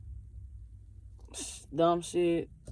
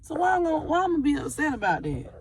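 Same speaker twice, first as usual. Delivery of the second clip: higher, faster retelling of her own frustrated thoughts; imitating her own baffled inner voice, pitched up; exasperated self-talk; 7 semitones higher than usual. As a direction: replay how she scolded herself, a little worked up. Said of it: "'Cause I'm like".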